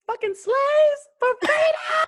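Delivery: high, slow, screeching mock shout; over-the-top rap diva yell, high and dragged out. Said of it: "you sound like [name]".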